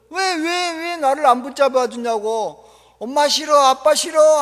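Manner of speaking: put-on wailing child. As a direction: whine like a hurt, betrayed kid. Child wails after being dropped. (울며 떼쓰는 아이 흉내)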